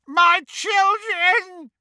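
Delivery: high, cracked, drawn-out scream; distraught bellow. A narrator's reading. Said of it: "he bellowed".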